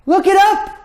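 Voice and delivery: sudden high, loud burst on up; emphatic exclamation. Real, not acted. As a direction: shout the command to wake them.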